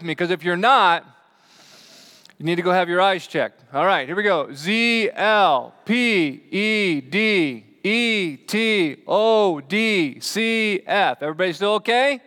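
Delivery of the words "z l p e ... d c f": slow, raised, drawn-out letters; calling out letters. Shown as a demonstration. Preacher testing the congregation's eyes on a chart, calling out slowly. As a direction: call each letter out separately, high and long.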